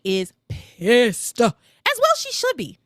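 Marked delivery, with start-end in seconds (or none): 1.4-2.8 pitched way up, emphatic; high, fired-up agreement. Real, not acted. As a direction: side with her loudly.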